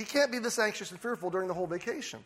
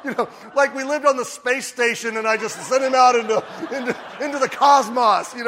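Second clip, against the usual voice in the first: laughing; 4 semitones higher, 7 dB louder than usual. Real, not acted. Laughing comic exaggeration.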